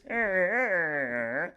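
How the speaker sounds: high throaty Kermit honk; a froggy honk, no words. Shown as a demonstration. Kermit heard from another room, throaty honk. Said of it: "You just hear this".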